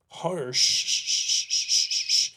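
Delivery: normal talking voice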